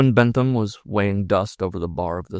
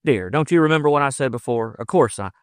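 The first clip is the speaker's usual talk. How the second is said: lightly voiced earnest frontiersman, raised pitch; reassuring lover; 5 semitones higher, 5 dB louder than usual. Lover reassuring the woman, mildly insistent. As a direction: reassure her with mild urgency.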